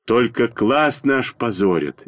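usual speaking voice

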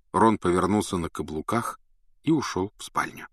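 ordinary delivery, nothing marked

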